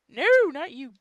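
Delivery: sharp, high and loud no, not you; a real, loud high-pitched outburst; sudden loud scold. Real, not acted. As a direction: snap it out loud at an interrupting device. Scolding the laptop mid-reading.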